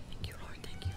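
whispering. Acted. Prayer whispered in tense moment.

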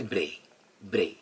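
"ब्रे" with a steady, pure e vowel; tongue-level A sound. Shown as a demonstration. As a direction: say "ब्रे" without gliding to ee. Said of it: "तो आपका ए बनता है".

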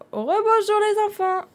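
put-on high, drawn-out gushing over the children's work; delighted teacher. Teacher admiring the children's painting, high gushing delight. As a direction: marvel loudly in a high, stretched voice.

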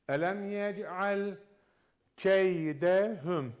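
slow chanted recitation; Quran recitation. Shown as a demonstration. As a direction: recite the verse slowly, with tajweed. Recites verse. (yavaş tecvidli tilavet)

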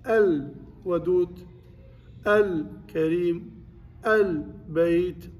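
"ال" held on a still, vowelless lam, then the noun; lam with sukun. Shown as a demonstration. Teacher tells class to still the lam, slow clear lam with sukun. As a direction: slowly separate "ال" from each word, lam fully sounded. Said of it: "أسكنه".

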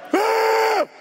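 sudden high yelled Ah; a piercing mock scream; imitated scream. Brother screaming at the comedian, loud high yell. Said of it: "He screamed at me like that".

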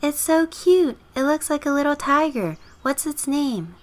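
very high, cooing over a kitten; high girlish gush; neighbor girl gushing. Acted.

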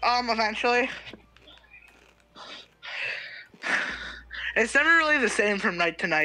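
talking while chewing; chewing into the mic; crunching chips. Real, not acted. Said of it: "hear me chewing into the mic".

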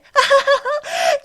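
high mocking giggle. Mocking friend's face.

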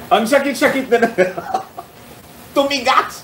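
laughing through the words; real laughter breaking into a complaint about a sore back; laughing while complaining. Real, not acted. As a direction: giggle out the complaint, pitch jumping up. Laughing at his stiff back.

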